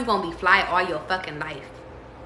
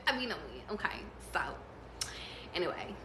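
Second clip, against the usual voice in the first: much softer, trailing to a whisper; fading whisper; 3 semitones lower, 15 dB quieter, faster than usual. Real, not acted. Reader winding down to Morgan, soft fading whisper. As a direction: let it die away under your breath.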